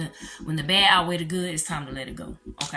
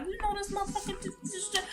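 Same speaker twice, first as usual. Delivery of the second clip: high, soft, stammering mock-crying; retold crying rant; 12 semitones higher, 9 dB quieter than usual. Telling how she called her sister crying, high mock-tearful stammer. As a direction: imitate crying down the phone, high and quiet. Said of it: "I was crying".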